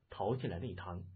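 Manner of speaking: plain reading voice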